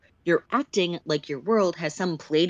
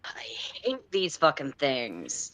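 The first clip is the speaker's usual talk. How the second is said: grumbling; 4 semitones lower, 5 dB quieter, slower than usual. Acted. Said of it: "They grumble".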